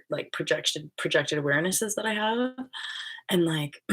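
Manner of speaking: ordinary talk, nothing marked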